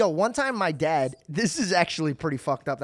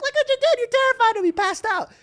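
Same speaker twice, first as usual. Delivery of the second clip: much higher, louder scolding mother; imitating upset mom; 7 dB louder than usual. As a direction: scold the dad like a frantic mom. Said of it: "And she's like, crying".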